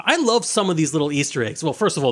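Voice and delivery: normal talking voice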